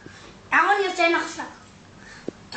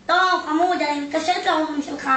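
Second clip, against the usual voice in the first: normal talking voice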